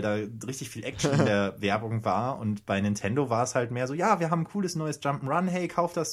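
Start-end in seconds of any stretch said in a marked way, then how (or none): 3.9-6.1 put-on high, cheesy kids' commercial pitch; mock ad voice. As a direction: sell it like a cheesy TV ad. Mock Nintendo ad voice. (übertriebene Werbestimme)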